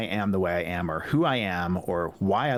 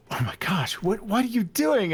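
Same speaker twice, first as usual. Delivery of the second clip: shocked wife; 10 semitones higher than usual. Retold or imitated. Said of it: "And she's like".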